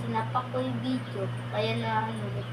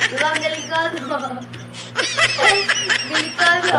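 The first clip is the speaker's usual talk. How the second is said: laughing brag; 3 semitones higher, 5 dB louder than usual. Real, not acted. Kid boasting laughing.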